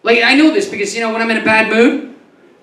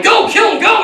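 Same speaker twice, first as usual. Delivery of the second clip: urging; 11 semitones higher than usual. Retold or imitated. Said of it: "I'm like".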